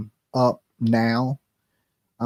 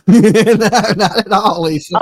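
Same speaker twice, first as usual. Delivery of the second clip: laughing; 4 semitones higher, 7 dB louder, slower than usual. Real, not acted. Laughs at bad connection.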